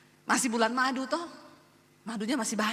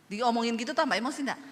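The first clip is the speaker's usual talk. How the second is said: ordinary delivery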